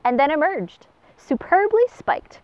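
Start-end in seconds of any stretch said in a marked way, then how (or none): 1.2-2.4 much higher, slow, drawn-out praise; the pelican praising the darter's catch with delight; delighted cry. Acted. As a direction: cry out in amazement. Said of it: "cried the pelican".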